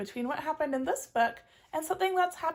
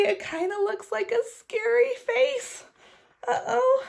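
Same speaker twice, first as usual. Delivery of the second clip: higher, a little slow and uneasy; nervous; 9 semitones higher than usual. Acted. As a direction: sound a bit spooked. Scared reading of picture.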